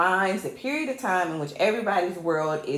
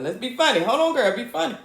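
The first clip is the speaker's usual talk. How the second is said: higher-pitched pep talk to oneself; self-coaxing; 5 semitones higher than usual. Real, not acted. Telling herself to be funny, self-coaxing. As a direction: coax yourself, pitch climbing.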